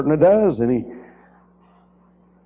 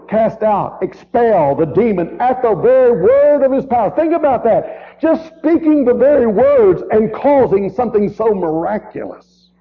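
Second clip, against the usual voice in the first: preaching climax; 6 semitones higher than usual. Acted. Preacher marveling at Jesus' power, fervent. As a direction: build to a fervent peak.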